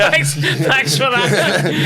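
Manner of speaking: laughing high through slow mock thanks. Real, not acted. To his co-host after a grim joke, laughing slow mock thanks.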